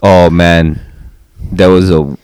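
normal talking voice

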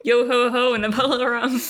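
hammed-up pirate chant; pirate accent. Joking that Hagrid is a pirate, mock pirate accent. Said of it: "Apparently Hagrid's a pirate now".

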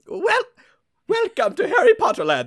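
high, loud hammed-up parody voice; shrieking in mock excitement, high and loud; mock Harry Potter fan. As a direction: squeal it like an overexcited theme-park fan.

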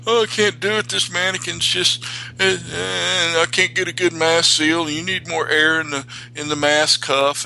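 high whiny complaining voice; whiny students. Retold or imitated. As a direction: whine like fed-up students. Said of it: "they are just whining".

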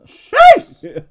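a thrilled yelp at the name; excited exclamation. Real, not acted. Reacting to Kate Beckinsale.

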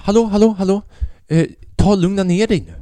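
imitating an official demanding attention; calling to interrupt. Inspector cutting in to calm him.

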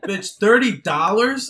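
loud, high, incredulous question; stretched-out, indignant retort; mock outrage. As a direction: scoff at the price in disbelief.

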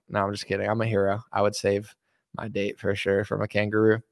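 ordinary talk, nothing marked

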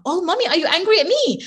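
put-on high, loud little-kid question; imitating concerned child. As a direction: voice a child checking on mommy. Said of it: "So they're like".

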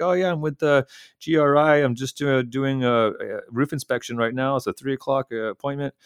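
high, smooth, official-sounding cover story; bluffing hotel managers with a breezy official tone; confident bluff. Retold or imitated. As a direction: sell the fake inspection like it's routine.

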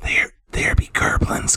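hushed, breathy whisper to the party; conspiratorial whisper about goblins; whispered warning. Acted. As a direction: lean in and whisper the danger. Player warns party in whisper.

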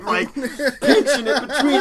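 laughing. Real, not acted. Laughing while telling embarrassing story.

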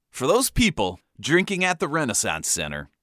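ordinary talk

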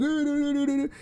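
high, panicky wordless babble; flustered babble. Retold or imitated. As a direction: babble like a frantic driver. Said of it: "waving his hands".

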